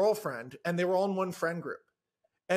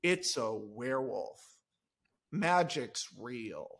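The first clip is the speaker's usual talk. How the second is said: slow, much softer, lower hush; awed wonder; 10 semitones lower, 8 dB quieter, slower than usual. Acted. Alexis seeing the werewolf, hushed awe. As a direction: breathe it like a discovery of nature. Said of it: "I play it like Gorillas in the Mist".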